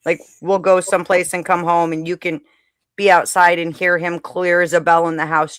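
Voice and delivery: usual speaking voice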